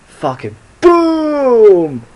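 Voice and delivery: high, drawn-out explosive boom; excited boom. Real, not acted. Telling the vlog, stretched high excited boom. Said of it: "blew up in my head".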